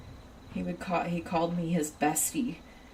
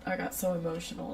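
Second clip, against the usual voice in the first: ordinary talk, nothing marked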